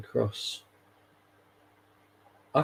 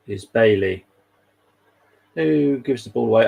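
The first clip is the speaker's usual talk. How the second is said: louder, driving play-by-play call; live commentary; 5 dB louder than usual. Acted. Mock live commentary of match.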